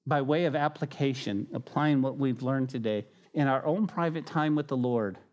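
usual speaking voice

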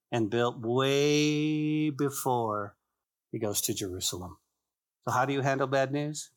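ordinary delivery

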